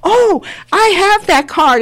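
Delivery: high, drawn-out delighted exclamation; imitating a pleasantly amazed shopper; delighted surprise. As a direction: exclaim it like a thrilled stranger recognizing it. Voices surprised woman at supermarket.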